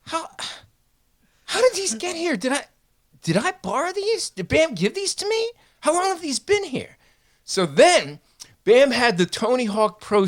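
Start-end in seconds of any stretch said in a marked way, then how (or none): 0.1-7.0 much higher, confused squeaky voice; baffled Deco. Retold or imitated. Deco wonders where the porno magazines came from, baffled. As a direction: wonder aloud, totally lost.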